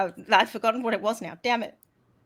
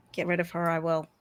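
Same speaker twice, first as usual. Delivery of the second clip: low menacing threat; 4 semitones lower, faster than usual. Acted.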